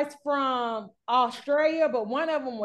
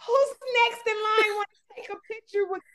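hype-woman friend; 8 semitones higher than usual. Retold or imitated. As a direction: announce the line like a hype woman. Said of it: "she was like".

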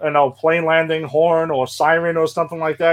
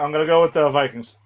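usual speaking voice

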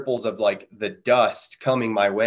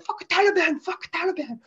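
imitating the soldier in a much higher voice, shouting his defiance; Afghan soldier. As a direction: play the excited little soldier firing into the air. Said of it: "And he's like".

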